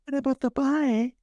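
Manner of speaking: high mock Southern belle; quick reprise of a high-pitched damsel voice; Alice. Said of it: "A Southern Belle from".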